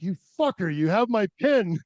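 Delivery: mock outrage. Climber teasing his old partner about the pin, mock outrage. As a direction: fake anger at an old friend.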